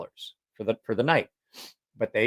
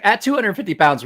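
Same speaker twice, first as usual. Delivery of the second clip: mock doctor voice; 10 semitones higher, 7 dB louder than usual. Doctor voiced in retold story.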